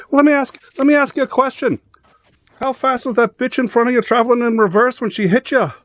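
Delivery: high stage-Irish, sly and deadpan; Irish cop. Retold or imitated. The cop springs the question on the driver, in a high Irish voice. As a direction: deliver the punchline in a high Irish lilt.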